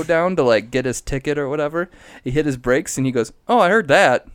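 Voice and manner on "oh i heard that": higher, louder sudden realisation; surprised. Retold or imitated. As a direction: react like you just noticed. Dad noticing the brakes.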